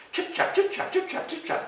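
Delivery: high, voiceless chip-chap; hoofbeat imitation. Reader mimicking goat trotting across bridge, whispery patter. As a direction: patter out hoofsteps evenly.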